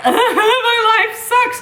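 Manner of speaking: high, slow, hammed-up wail; fake-cry it, laughing through it; mock sob. Mom-to-be mocking a self-pitying cry, laughing.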